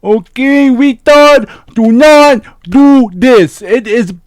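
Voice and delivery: loud, high, slow condescending telling-off; dumbed-down lecture. Pretend. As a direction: talk down to the viewer as if he were slow. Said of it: "now that we dumbed it down for you".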